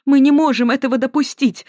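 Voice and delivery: ordinary delivery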